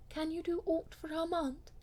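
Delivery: high, sweet, simple asking; sweetly and simply. Acted. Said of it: "she said sweetly and simply".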